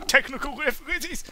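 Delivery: "Technical difficulties." with "difficulties" jumping way up high; high squeak. Shown as a demonstration. Streamer mocks a glitch, squeaking "difficulties" up high.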